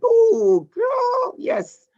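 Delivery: high, excited cheer with an ooh; excited squeal. Real, not acted. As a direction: squeal with delight for her. Cheering a student's chat answer.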